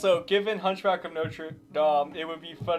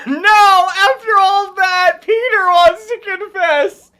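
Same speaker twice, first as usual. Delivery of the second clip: high, loud and slower; excited yelling; 13 semitones higher, 15 dB louder, slower than usual. Real, not acted. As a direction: shout it in disbelief. Reacting loudly to game event.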